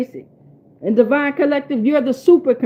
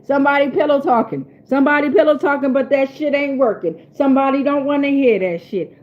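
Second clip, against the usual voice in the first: normal talking voice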